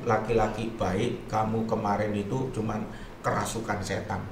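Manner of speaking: low, soft, gentle reassurance; tender forgiveness. Retold or imitated. Forgives the man, sitting beside him.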